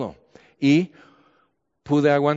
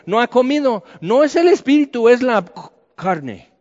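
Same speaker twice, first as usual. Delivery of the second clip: higher, louder insisting; insistent outburst; 8 semitones higher, 5 dB louder than usual. Real, not acted. Preacher scoffing at the vision talk, raised insistent voice. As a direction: push the point hard.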